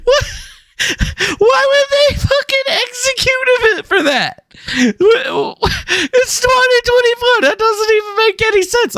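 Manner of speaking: high, cracking disbelief; shrill outrage at absurd news; incredulous outburst. Real, not acted.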